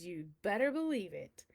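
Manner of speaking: ordinary delivery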